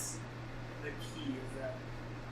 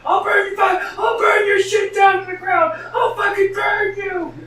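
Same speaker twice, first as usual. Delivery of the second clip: screaming furious threat, much higher; intimidating threat; 12 dB louder than usual. Acted. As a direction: roar it at the house to scare it. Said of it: "trickster key to intimidate the house".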